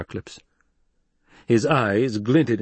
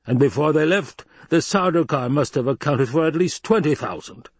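raised, emphatic argument; insistent protest; 7 semitones higher, 4 dB louder than usual. Acted. Baron defends his view to Hawat, insistent. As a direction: build the case, pitched high.

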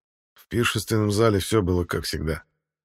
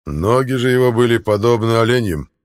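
booming warrior king; 3 semitones higher, 8 dB louder than usual. A narrator's reading. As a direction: boom it out, rage barely contained. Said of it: "закончил описание Артур". (громогласный король, сдерживающий ярость)

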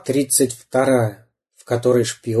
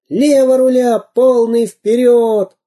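громкий клич; 11 semitones higher, 5 dB louder, slower than usual. Acted. Восторженные выкрики.

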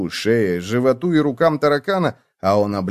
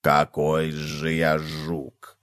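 low, slow, soft bug voice; a slow, low-down cockroach; 8 semitones lower, 5 dB quieter, much slower than usual. A narrator's reading. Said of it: "отмахнулся таракан".

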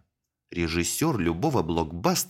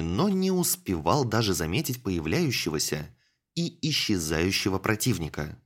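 plain reading voice

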